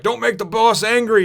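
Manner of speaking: high, loud underling voice; little henchman's warning. Retold or imitated. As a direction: imitate a minion warning someone off. Little subordinate warns the intruders.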